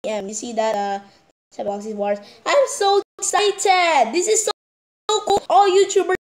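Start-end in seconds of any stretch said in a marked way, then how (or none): 2.5-6.2 high, thrilled gushing; excited. Real, not acted.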